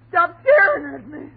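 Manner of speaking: panicked cry. Acted. Wife begging husband to stop staring, crying out. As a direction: cry out in terror.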